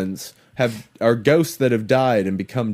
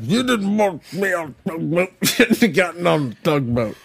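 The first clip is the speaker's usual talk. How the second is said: tugboat captain voice; 4 semitones higher than usual. Acted. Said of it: "bad impressions of a fat tugboat captain".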